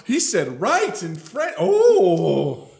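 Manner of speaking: high, slow, hammed-up awe; imitated amazement. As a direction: swell into a loud impressed "oh". Imitating guests impressed by his French.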